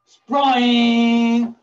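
loud, high, drawn-out burst; sudden trap snap. A narrator's reading. Storyteller springs the trap on the lion, sudden and loud. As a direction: make the trap spring loudly.

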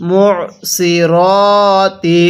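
"সি র" with a full, rounded, dark s and "র" held long as "ro"; heavy সদ and র. Shown as a demonstration. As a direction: draw out "র" as a heavy "ro", never "ra". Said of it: "রটাকে রা উচ্চারণ হলো". ("সি র"-তে মোটা সদ, র লম্বা টেনে "র")

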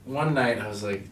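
normal talking voice

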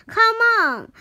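high, loud urging; yelled call. A narrator's reading. Harry calling Biff and Chip to run along, yelled. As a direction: yell for the others to follow. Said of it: "he yelled to Biff and Chip".

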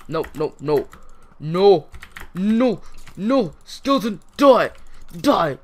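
loud, high, frantic yelling; escalating alarmed shouts; panicked shouting. Real, not acted. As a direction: yell at an attacker in a fight. Attacked by a skeleton in game.

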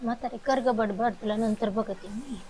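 very low, hushed grumble under the breath; slow, barely audible muttering; muttered grumbling. Acted. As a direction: mutter a threat behind the elder's back. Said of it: "तसली भुनभुन करायची नाहीस".

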